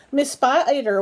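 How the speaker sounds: plain reading voice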